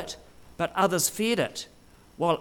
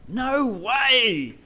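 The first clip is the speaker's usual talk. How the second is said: stretched-out, higher exclamation of disbelief; shocked disbelief; 4 semitones higher than usual. Shown as a demonstration. Preacher showing how people use the phrase, shocked disbelief. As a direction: exclaim it as if you can't believe it. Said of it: "an expression of shock or unbelief".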